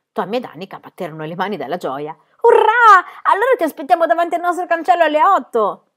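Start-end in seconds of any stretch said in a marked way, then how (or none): 2.4-5.9 put-on much higher, louder children's cheer; joyful cheer. A narrator's reading. Tommy and Annika cheer that Pippi will come to school, joyful. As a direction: cheer with delight.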